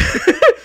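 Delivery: sudden high laugh; high burst of laughter. Real, not acted. Laughs recalling family teasing.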